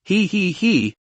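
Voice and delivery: breathy little he-he-he laugh; soft chuckle. Acted. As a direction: chuckle it quietly. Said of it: "said with a smile".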